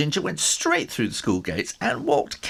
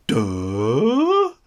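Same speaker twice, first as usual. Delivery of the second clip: hammed-up dopey grunt; dumb bully grunt; 7 dB louder than usual. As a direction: blurt a dim-witted duh.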